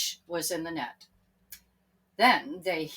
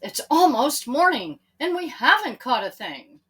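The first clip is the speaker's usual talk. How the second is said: complaining; 5 semitones higher, 8 dB louder than usual. Acted. Tired fisherman complaining.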